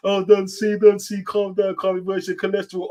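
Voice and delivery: teasing impression of a fan urging calm; mock fussing voice. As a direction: take the mick out of a nagging fan.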